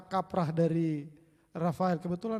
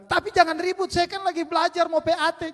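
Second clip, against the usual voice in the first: put-on older sister; 11 semitones higher, 7 dB louder than usual. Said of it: "Lalu kakaknya bilang".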